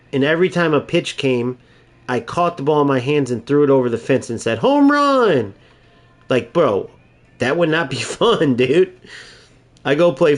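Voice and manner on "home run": high, excited "Home run!"; excited shout. Retold or imitated. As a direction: cheer it like a silly win. Mock celebration in sports story.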